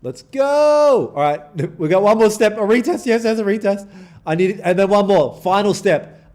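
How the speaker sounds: loud, high cheering rush; excited. Real, not acted. Streamer cheering his winning trade, loud and high. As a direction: shout it out as the trade pays off.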